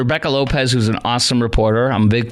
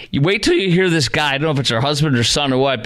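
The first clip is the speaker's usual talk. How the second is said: ordinary talk, nothing marked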